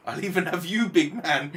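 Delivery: loud and rising, daring the big man on; loud threat. Retold or imitated. Woman pointing at Jason, shouting a loud challenge. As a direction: call him out loud and high. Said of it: "She went".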